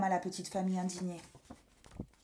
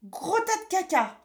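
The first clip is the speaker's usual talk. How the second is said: put-on high rude little boy; a naughty child cheerfully insulting; cheeky kid voice; 8 semitones higher, 4 dB louder than usual. A narrator's reading. Pierre insulting doctor.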